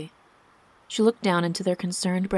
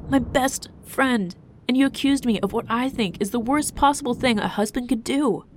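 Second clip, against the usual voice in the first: raised, high, emphatic reproach; wounded, indignant outburst; hurt, angry tirade; 5 semitones higher, 4 dB louder than usual. A narrator's reading. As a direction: vent betrayal with forceful stresses. Hurt husband's angry tirade at wife.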